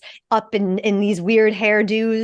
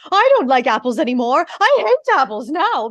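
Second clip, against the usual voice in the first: put-on high, fussy old-lady voice, a touch louder; Hugh Fraser's Ariadne; 4 semitones higher, 4 dB louder than usual. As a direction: play the dotty old crime novelist swearing off apples. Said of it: "I'm doing the voice that Hugh Fraser does".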